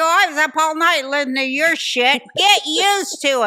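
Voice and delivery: loud, high retold telling-off; angry retort. Mother snapping back at noisy neighbour, angry retort. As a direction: fire back at the neighbour, projected.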